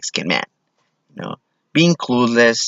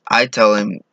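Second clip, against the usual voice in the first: normal talking voice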